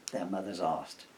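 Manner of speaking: ordinary delivery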